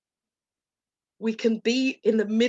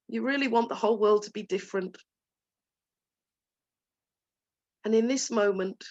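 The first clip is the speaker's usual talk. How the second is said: tender self-comfort. Shown as a demonstration. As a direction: soothe yourself gently, as if hugging a child. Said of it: "give ourselves a beautiful hug and say".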